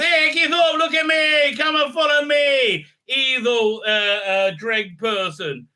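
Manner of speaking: high, loud hammed-up shout; mock taunting call. Jodie luring the creature to follow, mock shouted taunt. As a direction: yell to lure the monster over. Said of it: "then Jodie goes".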